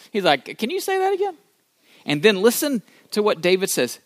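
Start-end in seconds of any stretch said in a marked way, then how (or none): 0.6-1.4 high-pitched, lightly voiced question; mock eager disbelief. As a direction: ask as if you can't believe the reward. David acts eager to hear reward.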